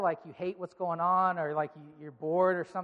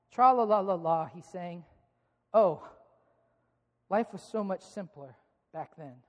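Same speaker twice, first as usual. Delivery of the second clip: storybook reading; 3 semitones lower than usual. Acted. Reads a children's storybook aloud.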